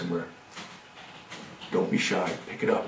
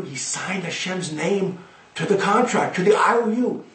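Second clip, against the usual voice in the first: plain reading voice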